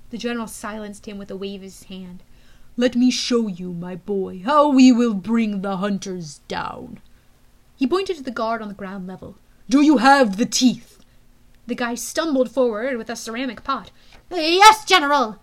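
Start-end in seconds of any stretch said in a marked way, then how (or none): 2.8-7.0 slow, loud commanding villain; menacing general. Acted. General's voice in story. 9.7-11.0 menacing general. Acted. 14.3-15.4 nervous guard. Acted.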